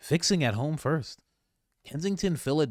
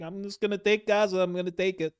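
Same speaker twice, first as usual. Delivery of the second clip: higher, blunt offhand declaration; mock Trump; 8 semitones higher than usual. Trump announcing his Gaza plan, higher offhand mock. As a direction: toss it off like it's settled. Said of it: "Trump's like".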